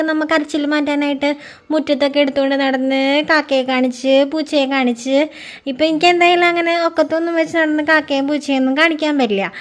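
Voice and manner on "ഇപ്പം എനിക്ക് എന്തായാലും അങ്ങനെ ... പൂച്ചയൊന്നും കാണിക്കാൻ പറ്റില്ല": normal talking voice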